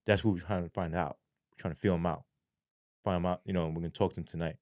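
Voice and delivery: low, soft, matter-of-fact reply; imitated low-key handler. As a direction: confide the plan calmly. Handler's secretive answer, retold.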